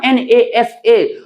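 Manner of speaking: "एन ए एस ए" spelled slowly as separate letters, not as one word. Shown as a demonstration. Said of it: "अगर आप इसको ऐसे बोलेंगे ना". ("एन ए एस ए" अलग-अलग अक्षरों में धीरे-धीरे)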